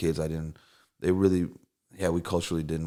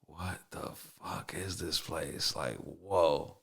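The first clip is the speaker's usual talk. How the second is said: awestruck; 7 dB quieter, much slower than usual. Retold or imitated. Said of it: "being like".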